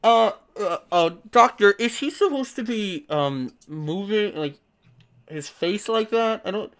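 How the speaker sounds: lightly voiced hesitant student; halting question from a worried trainee; nervous student. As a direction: stammer uncertainly at the doctor. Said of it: "one of the students says".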